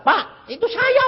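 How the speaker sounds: high-pitched eager reveal; excited exclamation. Acted. Former patient to the preacher, excitedly revealing himself. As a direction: blurt it out high.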